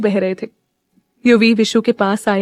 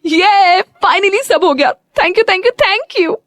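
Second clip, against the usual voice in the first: high, bouncing thank-yous, much higher pitch; jumping with joy; 9 semitones higher, much slower than usual. A narrator's reading. Meera thanking Neel, jumping for joy, high excited thanks. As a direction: squeal your thanks like you're hopping on the spot. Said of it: "खुशी से उछलते हुए बोली".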